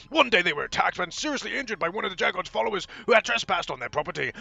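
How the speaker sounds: said fast. A narrator's reading. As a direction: race loudly through the backstory. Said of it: "why I said that so fast".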